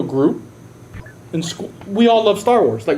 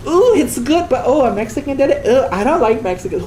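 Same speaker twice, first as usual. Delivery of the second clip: much higher, sneering put-on voice; mocking bigot; 8 semitones higher than usual. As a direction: parody a prejudiced viewer. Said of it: "I'm like".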